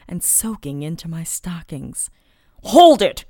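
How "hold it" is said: very loud, higher-pitched command; stern, insistent yell; shouted order. A narrator's reading. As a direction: bark it out forcefully. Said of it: "he ordered again".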